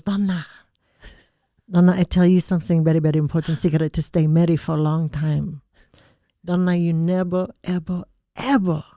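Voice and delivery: put-on proud Thai-accented mother giving solemn advice; Thai mom impression. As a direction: deliver weighty advice as a Thai mom, drawing out the end. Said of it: "and she goes".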